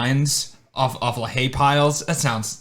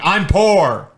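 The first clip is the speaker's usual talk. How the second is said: higher, louder whiny protest; mock whine; 7 semitones higher, 4 dB louder than usual. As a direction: plead poverty, hammed-up. Acted reply to a mixtape seller.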